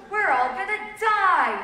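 high, loud cry of doom; old man's yell. A narrator's reading. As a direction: yell it out in mock panic. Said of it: "yelled the old man".

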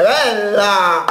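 ordinary talk, nothing marked

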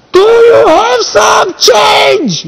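high, drawn-out holler through a funnel; megaphone yell. Retold or imitated. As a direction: bellow it slowly down the whole street. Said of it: "cu o pâlnie așa și urla la noi".

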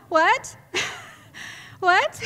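loud, high incredulous yelp; startled, rising question; shocked disbelief. Retold or imitated. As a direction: blurt it out high and loud, aghast. Retelling shock at offered punches.

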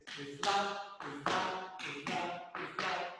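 "slap" repeated loudly as es-LA; "slap" in two syllables, second one punched; stress on the A. Shown as a demonstration. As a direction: drill "slap" with the accent on A. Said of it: "la A tiene el acento".

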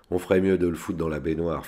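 plain reading voice

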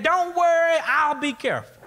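put-on high-pitched, carefree boy calling back; mock kid's yell. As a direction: brush off the warning in a high kid's voice. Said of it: "Johnny just kept charging forward and yelled back".

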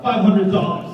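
loud, high blurted shout of a price; yelled offer. Retold or imitated. Friend blurting out an offer mid rent negotiation, loud shout. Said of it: "yells out".

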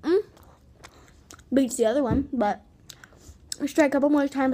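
talking around gum wad; chewing gum. Real, not acted. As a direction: mouth full of gum. Seen 2: Gum visible in open mouth at 0.4, 6.1; bubbles before.

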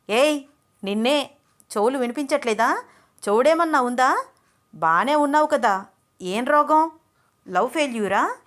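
loud, exasperated shouting; hammered, irritated yelling at a girl who won't answer; angry scolding. Acted. Venkat scolds silent girl.

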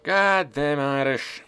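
loud, drawn-out, high-pitched cursing; slow exasperated yell; exasperated curse. Real, not acted. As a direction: curse them loudly, stretching each word. Cursing the Irish army in game.

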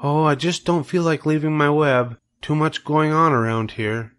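a gentle hint of a wise spider, hesitant; Charlotte the spider.

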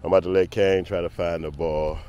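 normal talking voice

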